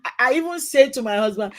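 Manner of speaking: normal talking voice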